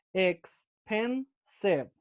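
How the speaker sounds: "expensive" broken into three slow, separate syllables. Shown as a demonstration. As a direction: say "expensive" very slowly, pausing between ex, pen and sive. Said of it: "three syllables".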